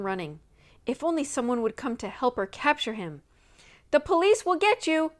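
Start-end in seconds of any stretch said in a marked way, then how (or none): 3.9-5.1 loud, high warning shout; called threat. A narrator's reading. Nancy calling a threat to scare the running sailor, loud and high. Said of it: "she called, hoping to scare him".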